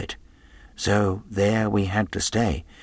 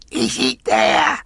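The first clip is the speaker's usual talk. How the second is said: slow, strained roar; rough, drawn-out bellow; roared question; 3 semitones higher, 4 dB louder, slower than usual. A narrator's reading. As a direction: demand it at the top of your voice. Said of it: "roared Pew".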